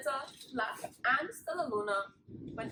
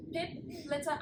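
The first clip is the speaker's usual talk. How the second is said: ordinary delivery, nothing marked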